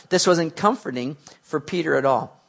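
usual speaking voice

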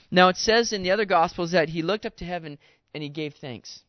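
usual speaking voice

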